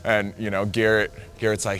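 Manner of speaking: ordinary delivery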